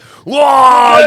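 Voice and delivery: shocked yell. Retold or imitated. Imitating the intruder from husband's view, loud yell. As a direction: burst in with a big startled shout.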